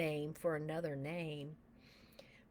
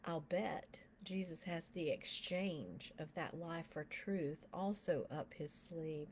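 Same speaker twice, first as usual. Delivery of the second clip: normal talking voice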